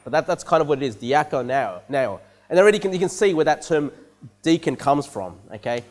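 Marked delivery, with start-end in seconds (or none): 1.0-2.2 very slow, lower "diakoneo"; "diakoneo" said low and slowly, as an unfamiliar Greek word; careful Greek pronunciation. Shown as a demonstration. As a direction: stretch out "diakoneo" syllable by syllable. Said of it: "I don't know if I'm pronouncing that right".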